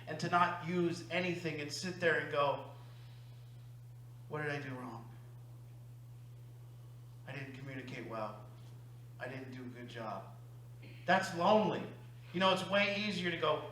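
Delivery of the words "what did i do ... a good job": much softer, lower, turned inward; quiet self-blame. Retold or imitated. To himself after a failed conversation, softly self-blaming. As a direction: murmur it like doubting yourself alone.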